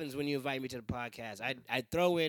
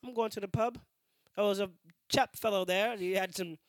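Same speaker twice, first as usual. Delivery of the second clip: higher-pitched, hammed-up British chap; mock British accent; 5 semitones higher, 3 dB louder than usual. Chap off to the pub for khakis, mock British accent. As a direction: improvise a silly British bloke. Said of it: "British people might say car keys".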